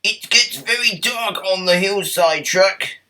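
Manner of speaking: high, careful tram voice; high concerned tram voice; Toby warning. Acted. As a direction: warn him gently but clearly. Toby warns.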